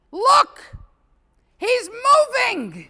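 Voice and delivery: very high, loud, slow startled cry; shocked mourner at a funeral; astonished mourner. Acted. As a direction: gasp out the discovery. Rabbi's wish from the coffin.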